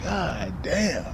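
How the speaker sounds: barely voiced, worn-out sigh of a curse; soft resigned grumble; muttered god damn. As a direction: mutter it like you're dragging yourself up. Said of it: "I'm like".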